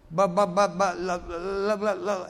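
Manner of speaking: loud, exaggerated stammer imitation; mock stutter, tripping on the tongue. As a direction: get stuck repeating each syllable. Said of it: "I mean, he stuttered".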